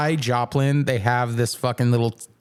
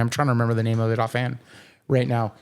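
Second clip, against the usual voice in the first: ordinary delivery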